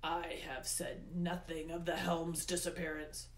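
understated snarl; controlled anger, kept at normal volume; Hades snarling. A narrator's reading. Hades explains the missing helm, snarling with restraint. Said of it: "Hades snarled".

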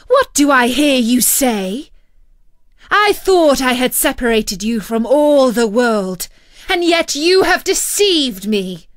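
loud, outraged witch voice; angry scolding. A narrator's reading. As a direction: berate the girl who deceived her. Enchantress scolds betrayed Rapunzel.

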